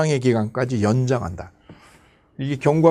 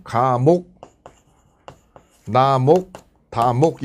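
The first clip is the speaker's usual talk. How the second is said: measured labels with long gaps; formal item reading; 3 semitones lower, much slower than usual. Shown as a demonstration. Teacher demonstrates the proper reading, measured and deliberate. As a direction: stretch "가목", "나목", "다목", pausing between. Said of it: "이렇게 리딩을 해요".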